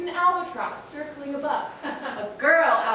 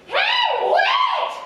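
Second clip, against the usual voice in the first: very high, loud bird voice; high screechy albatross; 8 dB louder than usual. A narrator's reading. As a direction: call down from the sky. Albatross voice in story.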